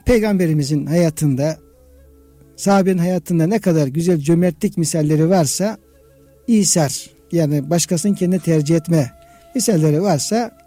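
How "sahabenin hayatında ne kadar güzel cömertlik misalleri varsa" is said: ordinary talk, nothing marked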